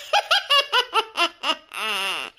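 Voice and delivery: low mocking villain laugh; sneering gang laugh. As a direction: laugh her down with contempt.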